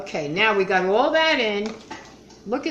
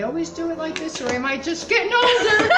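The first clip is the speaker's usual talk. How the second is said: laughing; 8 semitones higher, 4 dB louder than usual. Real, not acted. Laughs at own struggle.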